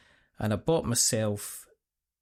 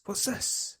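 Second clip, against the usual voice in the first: put-on high, whispered Scottish accent; 10 semitones higher, 5 dB quieter than usual. Owner voicing his dog Arlo's high whispered Scottish accent. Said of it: "He said in his Scottish accent".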